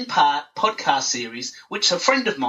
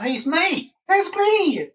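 high, chirpy show-off; mock selfie poster; 13 semitones higher, much slower than usual. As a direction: sing out each selfie.

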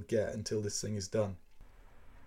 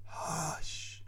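unvoiced, softer shush; frightened hush; 6 semitones higher, 5 dB quieter than usual. A narrator's reading. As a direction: shush the household anxiously. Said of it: "with a frightened face".

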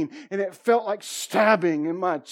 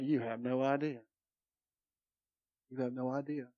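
knowing hush; 6 semitones lower, 11 dB quieter than usual. Retold or imitated. Speaker's knowing reply retold.

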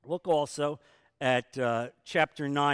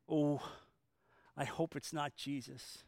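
mock dread; 10 dB quieter than usual. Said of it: "I kind of think he said".

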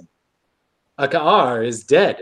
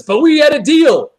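high, louder mock-indignant objection; hammed-up indignant protest; 11 semitones higher, 5 dB louder than usual. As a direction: protest like a cheated Klingon, pitched up. Voices Klingon's protest in recap.

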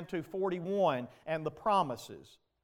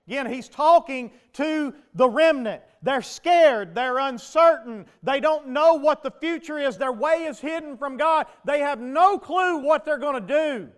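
loud, higher preaching at full force; fiery preaching; 6 semitones higher, 7 dB louder than usual. Acted. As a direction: preach it hard at the congregation.

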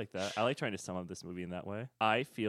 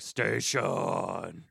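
low, drawn-out alien voice; Martian voice; 5 semitones lower than usual. Retold or imitated. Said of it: "in a Martian voice".

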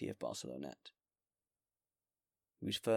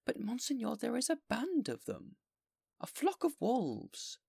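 high, louder, slowing on the wolves; fearful mayor; 5 dB louder than usual. Acted. Mayor warning the bishop about the band, high and fearful. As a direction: warn of the wolves in dread.

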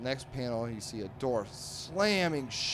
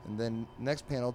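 ordinary delivery

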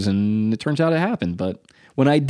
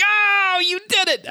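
much higher, delighted mock gasp; mock amazement; slower than usual. Friends seeing the finished tattoo, mock amazed. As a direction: gasp it in disbelief. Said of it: "It's like".

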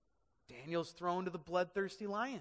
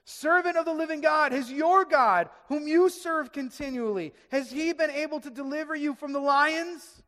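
raised, pleading call; anguished cry; 7 semitones higher, 8 dB louder than usual. Acted. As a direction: call out loudly, desperate for an answer. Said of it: "he cried out in a tone of anguish".

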